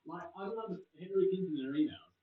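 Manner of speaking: ordinary talk, nothing marked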